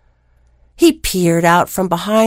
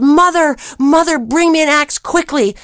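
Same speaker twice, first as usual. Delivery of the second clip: Jack yelling; 7 semitones higher than usual. Acted. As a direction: shout down to mother for the axe. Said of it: "He yelled".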